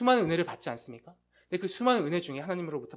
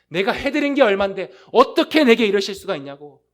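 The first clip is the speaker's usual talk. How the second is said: louder, mock-aggrieved grumble at God; resentful complaint; 3 semitones higher, 10 dB louder than usual. Preacher imitates a proud believer resenting God's hardship, louder and aggrieved. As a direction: vent the grudge, half-acted. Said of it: "원망과 응어리를 쏟아냅니다".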